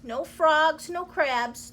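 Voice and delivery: ordinary delivery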